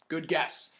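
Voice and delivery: plain reading voice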